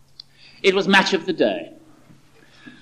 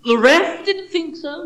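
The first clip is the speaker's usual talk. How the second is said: trace of smugness; 13 semitones higher, 5 dB louder, slower than usual. Acted. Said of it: "with just a trace of smugness in his voice".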